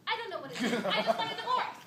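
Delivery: loud yell rising to a high peak; exasperated outburst. Acted. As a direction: burst out yelling at your husband. Wife's outburst demanding divorce.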